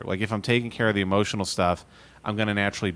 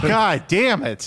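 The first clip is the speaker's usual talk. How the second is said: slow frustrated curse; 7 semitones higher, 3 dB louder, slower than usual. Acted. Frustrated exclamation.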